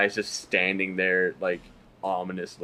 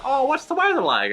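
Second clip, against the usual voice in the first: much higher, perky ad voice; mock chirpy woman; 3 dB louder than usual. Woman talking to her Google Glass, hammed-up high chirp. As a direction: sell it like a cheery commercial.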